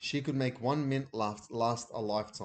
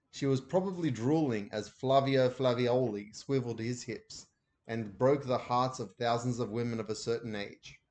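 ordinary delivery, nothing marked

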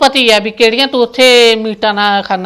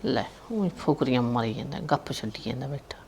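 whispered aside; 9 semitones lower, 14 dB quieter than usual. Acted. Mocking aside about the boaster.

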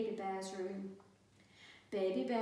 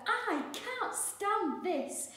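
high, slow, exasperated complaint; fed up; 11 semitones higher, 5 dB louder, much slower than usual. A narrator's reading. Mister Bear kept awake, slow fed-up complaint. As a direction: drag it out, at the end of your rope. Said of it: "said Mister Bear".

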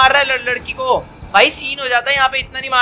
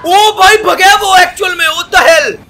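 panicked shout; 6 semitones higher, 8 dB louder than usual. Real, not acted. Gamer panics at chasing monster.

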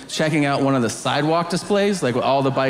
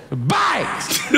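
much higher yelled mock word; 7 semitones higher than usual. Comedian quoting the prisoner yelling at the kid, high yell. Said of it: "he goes".

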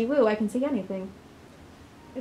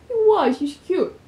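gushing; 8 semitones higher, 6 dB louder, slower than usual. Real, not acted. Player gushing about character.

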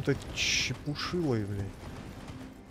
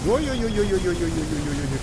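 alarmed; 5 semitones higher, 6 dB louder than usual. Real, not acted. Something flies at him.

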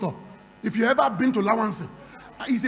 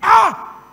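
exclaiming; 10 semitones higher, 11 dB louder than usual. Real, not acted. Dramatic exclamation in the testimony.

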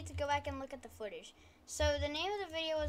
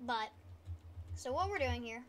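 ordinary delivery

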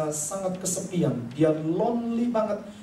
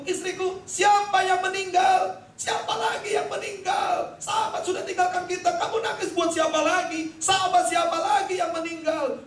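much higher, shouted questions; retold alarmed questioning; 12 semitones higher than usual. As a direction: demand to know who died. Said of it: "dia tanya gitu".

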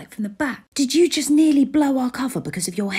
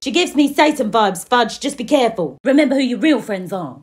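ordinary talk, nothing marked

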